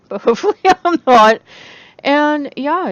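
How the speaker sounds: laughing. Real, not acted. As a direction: laugh it off hopefully. Laughing at herself.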